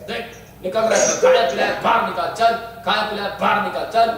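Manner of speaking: driving, rhythmic repeated orders; exorcism commands. Real, not acted. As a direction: urge it out with punched last words. Orders the spirit to expel. (बाहर निकालने का ज़ोरदार आदेश)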